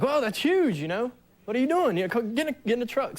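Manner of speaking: a father scolding his teen, hammed up; mock scolding dad. Stepfather scolding teen driver.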